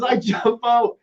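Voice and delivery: mock excited jump-out; Bob jumping out. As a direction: Bob shouting out the surprise. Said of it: "He goes".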